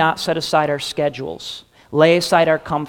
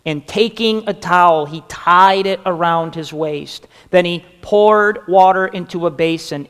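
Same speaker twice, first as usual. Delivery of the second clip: louder, measured reading of the verses; scripture reading; 5 dB louder than usual. Acted. Pastor reading verses while listeners circle action words, louder. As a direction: read the passage out for them to mark.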